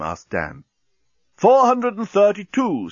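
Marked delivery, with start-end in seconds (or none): 1.4-2.9 slow, high, loud countdown; counting bomb. A narrator's reading. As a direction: call the number slowly, high and loud. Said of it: "said the bomb".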